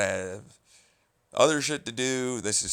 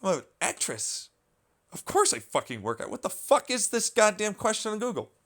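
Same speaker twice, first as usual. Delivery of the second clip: imitated outrage; 9 semitones higher than usual. Voicing the actress's exasperation, building outrage. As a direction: act out her exasperation, climbing. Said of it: "I'm like".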